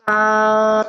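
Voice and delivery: slow, held out ba; long held sound. Shown as a demonstration. Teacher holding a whole note for kids. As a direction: stretch the ba long. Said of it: "one long sound".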